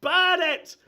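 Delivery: loud, high "burn it" yell; crowd shout. Acted. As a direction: scream it like a rioter. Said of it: "you hear an occasional shout of".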